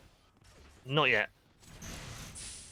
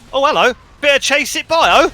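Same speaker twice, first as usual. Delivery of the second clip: excited commentary; 7 semitones higher, 7 dB louder than usual. Real, not acted. Live commentary, sudden action.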